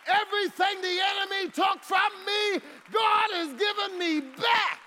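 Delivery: high, loud preacher's build to a shout; full-force preaching. Acted. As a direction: preach it, climbing to a roar.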